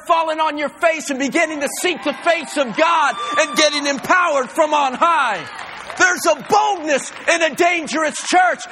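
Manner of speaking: loud, rising sermon voice; fiery preaching. Acted. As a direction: preach it with full fire. Preaching at full force.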